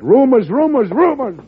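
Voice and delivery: exasperated outburst. Acted. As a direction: explode at the endless rumors. President exasperated by conflicting wires.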